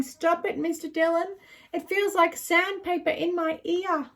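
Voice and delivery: high, loud complaint; mock complaint to a licking cat; whiny boy complaining.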